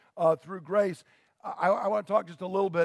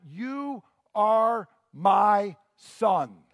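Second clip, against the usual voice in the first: YOU ARE MY SON stretched out word by word, louder; emphatic declaration; 5 dB louder, much slower than usual. Acted. Preacher voices Paul's emphatic appeal.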